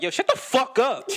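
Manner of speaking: a sudden high, loud blurt through a laugh; mock shout. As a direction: snap it out loud at a friend, half laughing. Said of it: "Like, out loud".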